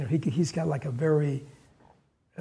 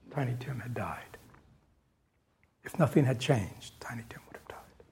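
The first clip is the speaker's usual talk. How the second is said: hushed grave whisper; 4 semitones lower, 8 dB quieter than usual. Real, not acted. Teacher recalling Tiny Tim's death, whispered. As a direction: breathe it like bad news.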